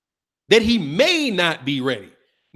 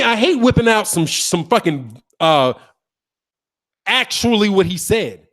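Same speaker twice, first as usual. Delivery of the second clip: usual speaking voice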